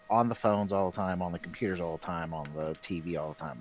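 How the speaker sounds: normal talking voice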